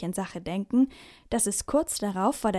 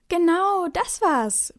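loud, higher relieved shout; calling out; 9 semitones higher, 5 dB louder, slower than usual. A narrator's reading. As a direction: cheer that he got it. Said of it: "rufen Finn und Anton".

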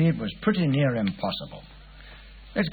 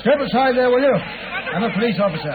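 calling out to crowd; 8 semitones higher, 3 dB louder than usual. Acted. Policeman orders crowd aside.

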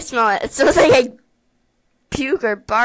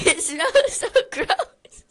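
laughing in disgust; 6 semitones higher, 3 dB louder than usual. Real, not acted. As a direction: laugh through the words.

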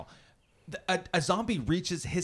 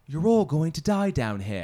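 put-on Red Queen's British accent; 2 semitones higher, 7 dB louder than usual. Podcast host quoting the film's villain, British accent. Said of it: "the Red Queen saying".

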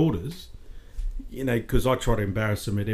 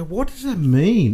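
higher, louder, slow baffled question; puzzled question; 8 semitones higher, 7 dB louder, slower than usual. Retold or imitated. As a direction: ask it slowly, baffled. Retold puzzled question to sister.

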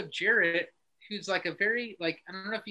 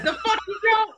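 laughing mock retort; 13 semitones higher, 8 dB louder than usual. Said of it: "You're like".

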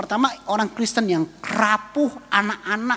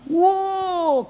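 a much higher, wide-eyed wow; an astonished, admiring gasp of a word; amazed wow; 10 semitones higher than usual. Acted.

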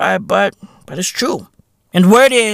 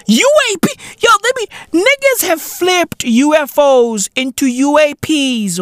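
mock outrage; 8 semitones higher than usual.

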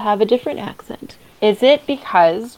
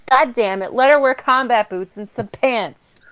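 ordinary delivery

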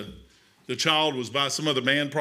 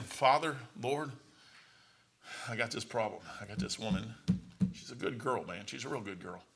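much softer, lower troubled prayer; Joseph praying quietly; 4 semitones lower, 9 dB quieter than usual. Retold or imitated. Joseph quietly praying about Mary, lower and much softer. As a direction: murmur it low to God. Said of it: "I can just hear him saying it in his head".

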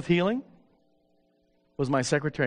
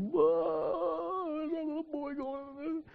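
hushed awe; 13 semitones higher, 7 dB quieter, slower than usual. Retold or imitated. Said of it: "and we're just".

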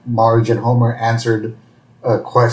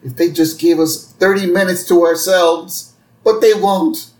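mock tearful plea; 6 semitones higher than usual. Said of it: "And he starts crying".

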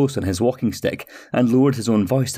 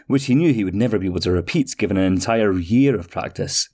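ordinary delivery

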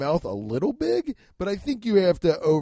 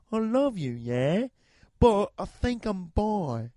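[name]; slower than usual. Retold or imitated. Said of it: "I like doing this voice".

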